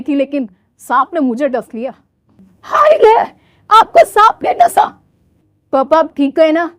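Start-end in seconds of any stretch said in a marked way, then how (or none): 2.6-4.8 high, loud wail of mock alarm; hammed-up cry of shock at the news; loud, higher melodramatic gasp of dismay. Bride shocked at snake bite.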